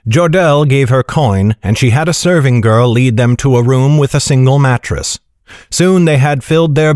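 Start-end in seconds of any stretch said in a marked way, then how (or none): none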